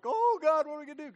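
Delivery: very high, loud hammed-up wail; panicked Moses. Moses wailing at the Red Sea, hammed-up panic. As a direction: cry out in mock despair to God. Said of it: "And Moses is like".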